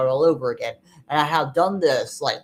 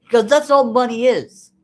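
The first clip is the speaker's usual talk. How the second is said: angry; 7 semitones higher, 8 dB louder than usual. Real, not acted. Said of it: "and I'm angry".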